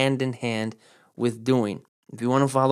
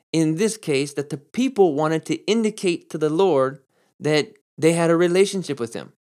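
usual speaking voice

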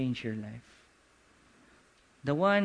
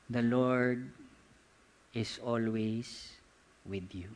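slow, hushed and lower, with long pauses; 5 semitones lower, 4 dB quieter, slower than usual. Shown as a demonstration. Preacher giving the congregation today's word, very slowly. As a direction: let each phrase land slowly, sinking softer. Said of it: "I'm gonna say this very slowly".

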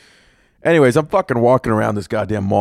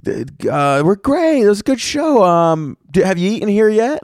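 very high imitation of women chatting; chatty women; 9 semitones higher than usual. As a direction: put on a high, friendly female voice.

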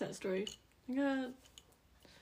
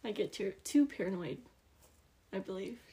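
normal talking voice

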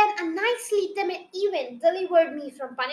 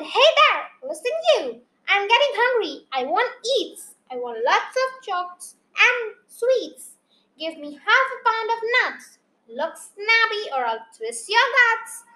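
raised, higher storybook shout; demanding shout; 5 semitones higher, 4 dB louder than usual. A narrator's reading. Tummy beast demanding food, raised demanding shout. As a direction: call out greedy orders with punch. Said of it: "It shouted".

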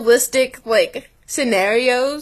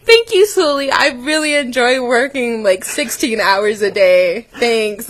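sarcastic thanks. Retold or imitated. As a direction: thank her with biting sarcasm. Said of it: "And I was like".

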